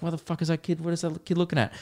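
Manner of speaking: quiet baffled mutter under the breath; inner muttering. Retold or imitated. Shopper eyeing a staring kid, quiet puzzled mutter. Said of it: "You're like".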